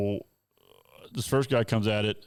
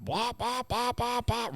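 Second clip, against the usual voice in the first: much higher rhythmic wop sounds mimicking hopping tires; imitated tire bounce; 14 semitones higher, much slower than usual. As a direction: bounce out each wop like a tire leaving the ground.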